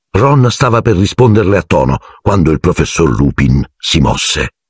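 ordinary delivery, nothing marked

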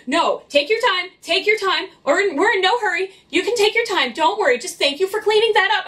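high-pitched, over-accommodating mock customer; imitated backpedaling customer. Voicing a suddenly accommodating customer.

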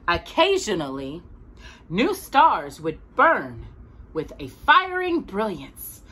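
storybook voice for a little listener; gentle read-aloud narration; reading to a child. A narrator's reading. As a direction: read it like a bedtime picture book. Reads picture book to child.

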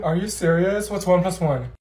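normal talking voice

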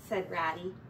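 plain reading voice

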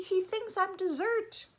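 put-on high-pitched frightened child; alarmed cry. A narrator's reading. Eddie tells Mom the bear will eat him, crying out. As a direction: wail it as a panicked little kid. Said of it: "cried Eddie".

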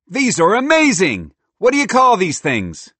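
high, loud squirrel exclamation; thrilled amazement. Acted. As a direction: gush loudly over new food. Squirrel thrilled by first nuggets.

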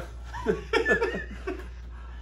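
breathy teasing laugh; a real burst of laughter. Real, not acted. Teasing friend about old calling.

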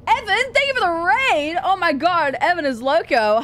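high, loud delighted thanks; excited. Real, not acted. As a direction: gush over the raid.

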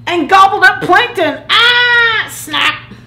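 loud, high storytelling burst; dramatic climax. A narrator's reading. Whale gobbles up his best friend, loud dramatic climax. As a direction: build high and loud, then snap shut.

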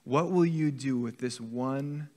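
ordinary delivery

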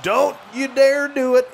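high, slow, tense plea; begging the shot not to go in; don't you dare, said high and drawn out; tense pleading. Real, not acted. Tense moment, opponent shooting.

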